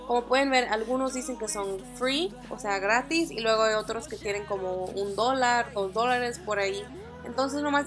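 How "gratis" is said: ordinary talk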